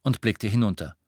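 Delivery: usual speaking voice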